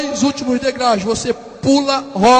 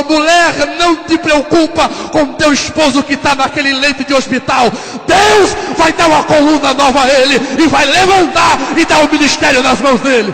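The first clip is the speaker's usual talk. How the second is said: profecia bradada; 3 semitones higher, 7 dB louder than usual. Retold or imitated. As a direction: thunder it out as a preacher's promise from God. Pastor profetiza à esposa. (pregador bradando profecia, alto e forte)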